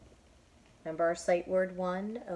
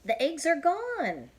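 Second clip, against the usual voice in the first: much higher, louder hopeful line; hopeful Elephant; 10 semitones higher, 8 dB louder, slower than usual. Acted. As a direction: ask hoping the trouble is over.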